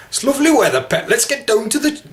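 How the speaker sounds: very high sing-song Newcastle accent; hammed-up Geordie, pitched right up; attempted Geordie. Shown as a demonstration.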